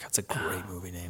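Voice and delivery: hushed aside; whisper. Real, not acted. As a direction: breathe it under your voice.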